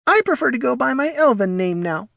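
very high, airy little kobold; lofty, affected small-creature voice. Acted. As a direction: put on airs in a tiny high voice. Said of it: "said Morty airily".

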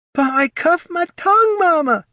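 high, loud little pup whine; whining pup. A narrator's reading. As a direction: whine like a hurt pup to mama. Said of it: "whined one of the pups".

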